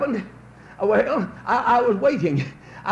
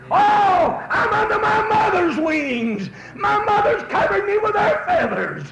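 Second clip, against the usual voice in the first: put-on excited baby chick; 9 semitones higher, 4 dB louder than usual. Preacher voicing a chick safe under its mother's wings, high and loud. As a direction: crow it out like a safe little chick. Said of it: "it said something like this".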